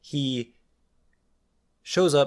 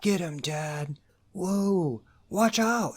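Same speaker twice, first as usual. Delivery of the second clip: whisper yelling; 7 semitones higher, much slower than usual. Retold or imitated. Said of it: "but he's whisper yelling".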